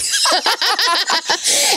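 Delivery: bright burst of laughter; high laugh. Real, not acted. Laughing at her own joke about childhood.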